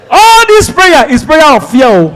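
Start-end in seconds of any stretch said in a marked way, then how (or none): none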